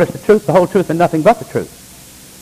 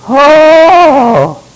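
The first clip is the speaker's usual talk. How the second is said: mock ecstatic; 10 semitones higher, 6 dB louder than usual. Mocking ecstatic TV worship.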